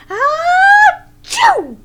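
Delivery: loud high sneezing bear; exaggerated storybook sneeze; big sneeze. A narrator's reading. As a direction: blast out a huge sneeze. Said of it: "He blows and he sneezes".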